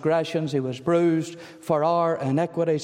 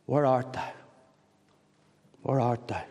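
soft, tender asking; 4 semitones lower, 4 dB quieter than usual. Acted. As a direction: ask it gently, lower and softer. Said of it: "God is tenderly asking you".

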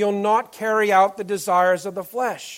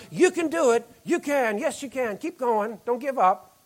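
mock cheerleader; 4 semitones higher than usual. Preacher mimicking a cheerleader to the congregation, mock cheer. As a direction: cheer them on like a sideline cheerleader. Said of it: "and shout".

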